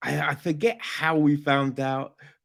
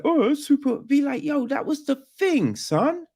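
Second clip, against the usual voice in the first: mock casual quote; 10 semitones higher than usual.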